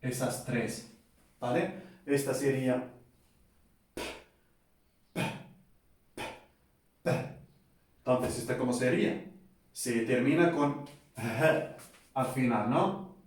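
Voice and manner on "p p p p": P con aire soplado hacia fuera; P con aspiración audible; aspirated P. Shown as a demonstration. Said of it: "Es como H al final". (P aspirada)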